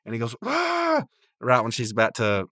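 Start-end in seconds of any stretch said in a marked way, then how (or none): 0.5-1.0 high, short imitated scream; mock yell. Rigby yells to spoil Margaret's putt, high mock scream. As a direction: yelp in a high voice, not full force. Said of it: "yell as loud as you can".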